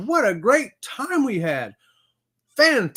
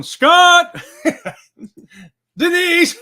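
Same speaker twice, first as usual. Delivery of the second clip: high, loud call of the name; playful yell; 10 semitones higher, 10 dB louder, much slower than usual. Retold or imitated. Host reading a viewer's greeting as a loud, high yell. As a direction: shout the name across the room. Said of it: "Bone Tired yelling".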